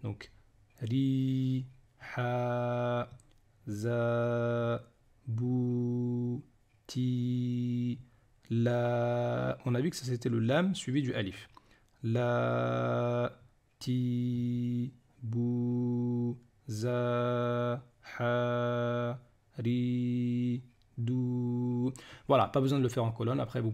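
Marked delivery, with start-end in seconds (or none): 0.8-9.6 "ri, ha, za, bu, ti, la", each vowel held long; evenly drawn-out syllables, "ri, ha, za, bu, ti, la". Shown as a demonstration. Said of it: "ça va se prolonger en A". 12.0-21.9 "La, ti, bu, za, ha, ri, dou", each vowel held long; evenly sustained syllables, "La, ti, bu, za, ha, ri, dou". Shown as a demonstration. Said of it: "ça va se prolonger en A".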